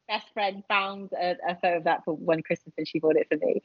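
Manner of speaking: ordinary talk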